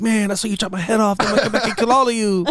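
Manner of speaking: high, laughing comic impression, speeding up; Michael Pena recap. Thanos recounting his beheading to amused friends, Michael Pena recap. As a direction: crack up while voicing a goofy villain. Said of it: "Thanos was all conflicted because he's like".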